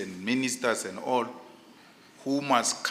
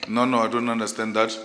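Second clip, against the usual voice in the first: usual speaking voice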